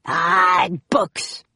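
disgusted pirate captain's growl. A narrator's reading. Captain Bones scorns the find, growling. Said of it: "growled Captain Bones".

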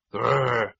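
short animal growl; tiger growl. A narrator's reading.

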